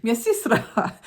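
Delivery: high, slightly slow Russian words; imitating a Russian woman. Woman from the coma pointing at her sister. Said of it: "she's like".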